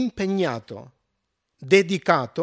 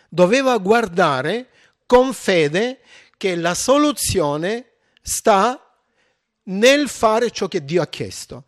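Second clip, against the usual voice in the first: preaching at full force; 3 semitones higher, 6 dB louder than usual. Acted. Preacher urging the congregation to look with faith, loud and fervent. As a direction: drive the point home to the congregation.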